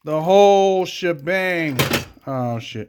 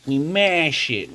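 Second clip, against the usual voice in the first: ordinary delivery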